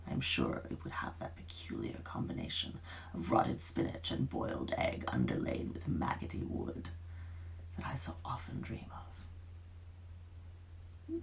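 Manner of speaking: plain reading voice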